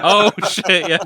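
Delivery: loud laughing shout of surprise; laughing outburst. Real, not acted. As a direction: burst out laughing. Reacting to misclicks joke.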